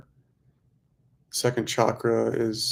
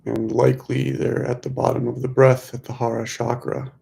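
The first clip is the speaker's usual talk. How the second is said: measured mantra pacing, leaving room to breathe; guided meditation. Real, not acted. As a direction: lead them gently through the breath, stretching key words.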